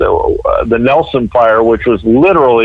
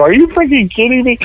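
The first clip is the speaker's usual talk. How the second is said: much higher, fast mock disbelief; exasperated; 12 semitones higher, faster than usual. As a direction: fire it off in retold disbelief. Exasperated at excuse-making neighbor.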